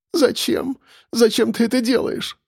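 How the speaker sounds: high, tearful sobbed plea; sobbing. A narrator's reading. Captive woman asks her captor why, sobbing. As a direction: plead through sobs, pitched well up. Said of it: "всхлипнула она".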